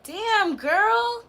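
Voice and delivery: put-on high, impressed "damn, girl"; friend's impressed exclaim. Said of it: "she was like".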